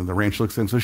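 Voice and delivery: usual speaking voice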